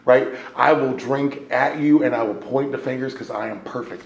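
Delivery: ordinary delivery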